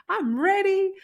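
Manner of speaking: high, loud declaration of readiness; excited "I'm ready"; eager burst. Retold or imitated. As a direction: fire it out like you can't wait. Retold eager self-talk, ready.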